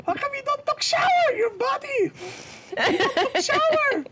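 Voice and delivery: put-on a pushy Filipino nurse nagging in her accent. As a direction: Filipino accent, nurse scolding him to shower. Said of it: "there was a Filipino nurse".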